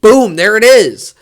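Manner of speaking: loud, drawn-out triumphant call; mock triumphant boom. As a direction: announce it like you found the winner. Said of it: "that I'm like".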